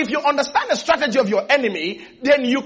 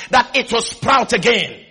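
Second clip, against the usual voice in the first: ordinary delivery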